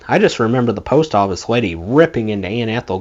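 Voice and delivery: ordinary delivery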